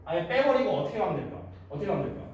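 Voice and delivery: usual speaking voice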